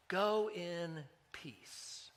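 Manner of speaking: Jesus sending the healed woman off, very slow and soft; gentle blessing. Acted. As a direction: bestow it tenderly, word by word.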